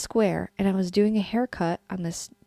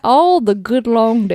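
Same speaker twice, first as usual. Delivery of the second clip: slow, louder Charleston drawl; Charleston accent; 8 dB louder, slower than usual. Pretend. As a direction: stretch it out in a Southern Charleston accent. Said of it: "I would have a fake Charleston accent".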